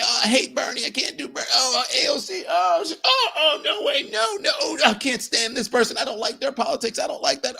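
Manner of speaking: very high, squealing mock whine; fussy, hammed-up voice imitating a horrified picky voter; mock whiny complainer. Host imitating Democrats who reject their own candidates, mock whiny squeal.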